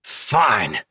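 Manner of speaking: growl. A narrator's reading. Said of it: "he growls".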